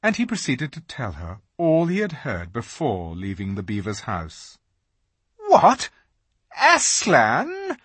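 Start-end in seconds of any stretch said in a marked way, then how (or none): 5.5-7.8 high, loud, shocked witch; startled outburst; the Queen cries. A narrator's reading. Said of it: "cried the Queen".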